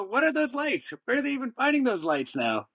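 high, incredulous what are those lights; baffled mock question. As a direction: play it puzzled and mocking. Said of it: "You go".

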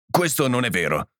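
usual speaking voice

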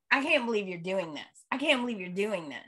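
fast, softened imitation of disbelief; mock hurt complaint. Imitating daughter accusing her dad, quick soft complaint. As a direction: rattle it off quietly as her complaint.

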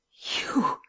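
hushed, high gasp of shock; stammered. A narrator's reading. Man recognises his rescuer, stammering. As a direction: breathe it out in astonishment. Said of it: "he stammered".

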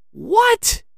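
very high squeak of shock; shocked exclamation. A narrator's reading. Lance sees his uncle unmasked, shocked. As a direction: burst out in high disbelief. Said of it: "he exclaimed".

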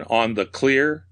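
plain reading voice

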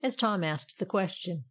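ordinary delivery

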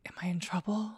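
hushed, nervous whisper to herself; worried whisper. Retold or imitated. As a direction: whisper it like you're about to get scolded. Imagined inner worry at full name.

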